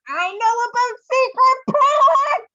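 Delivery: high, loud, slow and sing-song; teasing excitement. Real, not acted. To consultants, teasing the secret product, high and sing-song. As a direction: dangle the secret in front of them.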